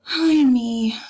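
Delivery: long sighed-out lament, falling in pitch; sighing. Acted. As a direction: let it out as a big dramatic sigh. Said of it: "she just kinda sighs".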